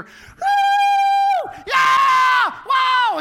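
very high, very loud cheers from the next room; a burst of wild football-party cheering; crowd cheering. Retold or imitated. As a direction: whoop it up like fans at a big play.

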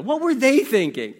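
high, loud incredulous question; hammed-up disbelief, hitting the first word; exasperated parent. Parent thinking back about the kids, exasperated disbelief.

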